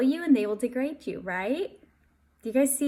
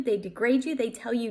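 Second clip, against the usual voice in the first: normal talking voice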